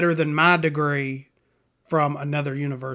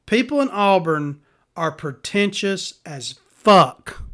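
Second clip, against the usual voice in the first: slow, loud announcer read; public service announcement; 4 dB louder, slower than usual. Shown as a demonstration. Announcing to the public in a slow announcer voice. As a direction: proclaim it like an official PSA. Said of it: "I'm gonna put out a public service announcement".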